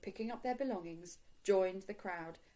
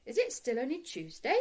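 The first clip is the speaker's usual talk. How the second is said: higher-pitched innocent question; 6 semitones higher, 5 dB louder than usual. A narrator's reading. Paddington asking Mister Brown about the day, innocent. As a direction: ask it lightly, all innocence. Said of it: "asked Paddington innocently".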